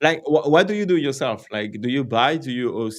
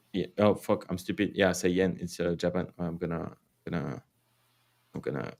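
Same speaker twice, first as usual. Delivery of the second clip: ordinary talk, nothing marked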